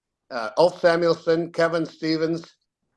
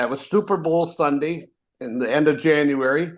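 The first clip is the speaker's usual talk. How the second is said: ordinary talk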